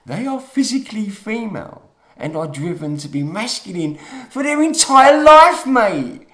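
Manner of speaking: worked up, rising to a loud high peak; real agitation building into an emphatic outburst; getting upset. Real, not acted. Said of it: "getting upset".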